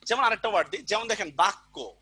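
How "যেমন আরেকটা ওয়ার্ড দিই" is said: ordinary delivery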